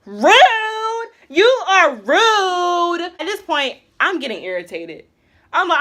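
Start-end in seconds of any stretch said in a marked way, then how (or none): 0.1-3.0 a high, loud, slow "Rude", drawn out; offended. Retold or imitated. To Zach who ignored her, slow offended scolding. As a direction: call him rude with mock offense, stretching it.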